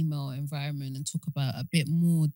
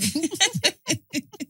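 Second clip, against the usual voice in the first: embarrassed laugh; 12 semitones higher than usual. Real, not acted. Laughs at own choking.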